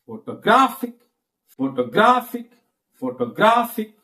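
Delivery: "Photographic" with GRA high and punched; stress on third syllable. Shown as a demonstration. Teacher demonstrating shifting stress, strong third syllable. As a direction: save the weight for GRA in "Photographic". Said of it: "third syllable".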